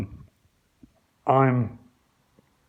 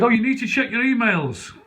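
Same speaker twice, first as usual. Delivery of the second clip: mock police; 8 semitones higher, 4 dB louder than usual. Police voiced.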